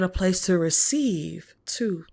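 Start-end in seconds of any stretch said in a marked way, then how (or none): 1.7-2.0 whispered word. Real, not acted. Intimate tarot message.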